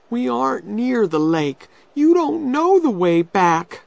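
put-on alarmed girl. A narrator's reading. Becky realising Tom has lost the way, alarmed.

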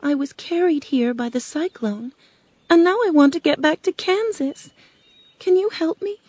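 put-on high, forlorn girl's voice; pleading young Dorothy. Dorothy asks the witch to get her home, pleading.

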